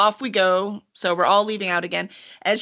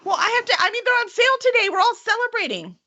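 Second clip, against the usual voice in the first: high, bright excuse-making; eager girl; 13 semitones higher than usual. Acted. Sister justifying the statue to the party, high and eager. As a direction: brush off the worry cheerfully. GM voicing NPC excitedly.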